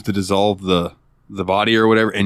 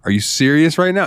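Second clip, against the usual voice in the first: higher, disbelieving mock question; incredulous; 7 semitones higher than usual. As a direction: say it in stunned disbelief. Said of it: "she's like".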